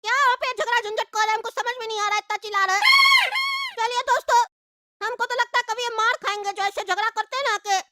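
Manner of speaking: put-on high child's voice, scheming and hungry; a kid talking to himself about food. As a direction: play a greedy little kid plotting. Child character voice again.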